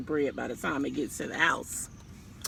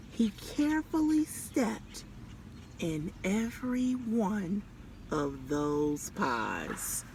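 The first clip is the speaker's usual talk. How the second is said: soft, slowing comic narration; slow it down for the joke; drawn-out soft reveal; 6 dB quieter, slower than usual. A narrator's reading.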